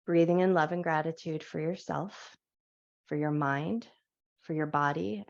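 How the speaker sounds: calm, gentle guiding voice through each stretch; guided yoga instruction. Acted. Yoga teacher guiding her class through a wake-up stretch, calm guided voice.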